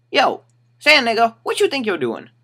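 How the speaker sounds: high, loud mock confrontation; retold challenge. Man confronting the storyteller, retold challenge.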